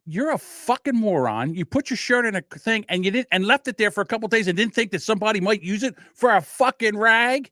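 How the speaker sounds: high, exasperated rant; retold argument. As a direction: scold the cousin as in a heated quarrel. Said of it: "him and I are nose to nose spitting".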